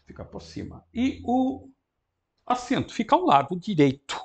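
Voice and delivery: ordinary talk, nothing marked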